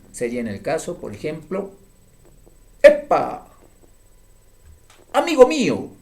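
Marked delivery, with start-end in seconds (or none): 2.8-5.9 loud, high exclamation showing emphasis; emphatic exclamation. Shown as a demonstration. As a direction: call it out loudly and higher, like greeting a friend. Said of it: "Estoy expresando un estado más enfático". (exclamación enfática, más fuerte y aguda)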